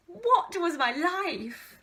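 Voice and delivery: drawn-out exasperated cry. Real, not acted. Exasperated at old diary.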